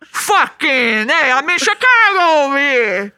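loud New York Italian shouting; New York Italian accent. Pretend. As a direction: bellow like a street tough. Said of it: "a New York accent".

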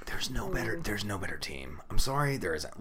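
soft sensual murmur about a beloved team. Real, not acted. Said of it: "talking about the Panthers makes us very sensual".

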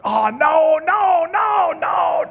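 very high, loud playful no's; mock denial. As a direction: dodge the question in a comic panic. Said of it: "I try to keep that on the down low".

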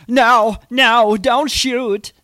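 high, loud panicked surrender shout; Alan Rickman American accent. Retold or imitated. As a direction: beg them not to shoot, panicking. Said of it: "I can do his American".

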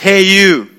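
loud, curt command; barked order from a border guard; customs officer's order. Retold or imitated. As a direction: call out like an official stopping someone. Customs officer calls him over.

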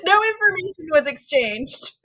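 amused laughing question. Retold or imitated. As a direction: laugh while asking. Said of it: "And it was like".

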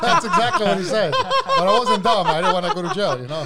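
laughing through the words, pitch jumping high; can't stop laughing while telling it. Real, not acted. Laughing at the retold fight.

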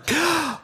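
short sharp high intake of breath; mock gasp. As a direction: gasp as the whole class holds its breath. Room reacting to tension.

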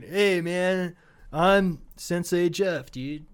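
slow, drawn-out stoner voice; stoner sensei. Acted. Stoner comedy sensei greeting his class, slow and dopey. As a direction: introduce yourself like a laid-back stoner, dragging it out. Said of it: "a two thousand stoner comedy".

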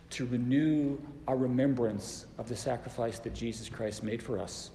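ordinary talk, nothing marked

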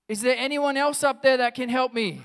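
high, full-volume shout for help; yelling out. Acted. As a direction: yell upward for anybody else at all. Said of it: "and he yells out".

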